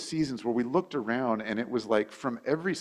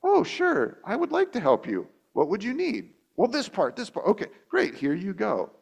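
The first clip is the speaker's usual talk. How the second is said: much higher, sweet and gracious helper; 8 semitones higher than usual. Retold or imitated. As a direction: answer kindly like a patient parent. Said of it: "respond graciously and go".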